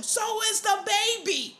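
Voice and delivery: ordinary talk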